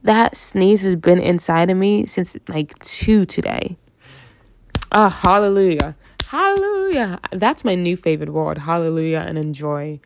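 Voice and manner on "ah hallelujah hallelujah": preacher praise. Pretend. Relief after the sneeze.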